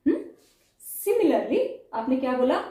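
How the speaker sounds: normal talking voice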